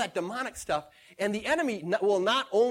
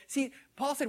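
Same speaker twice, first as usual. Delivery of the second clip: ordinary delivery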